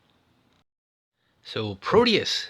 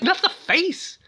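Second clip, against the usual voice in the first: high squealed mock plea; mock panic; slower than usual. Narrator mimicking a frightened actress, high squeal. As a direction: shriek it like shielding your face. Mock cry protecting face.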